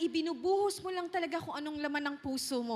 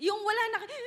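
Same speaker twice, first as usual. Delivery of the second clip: crying; 6 semitones higher, much faster than usual. Shown as a demonstration. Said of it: "nakaiyak na kayo ng ganon".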